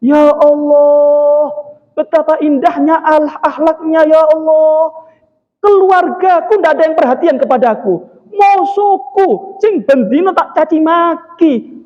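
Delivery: high, drawn-out acted wail; hammed-up weeping lament; tearful crying. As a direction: wail in awe and remorse, stretching the words.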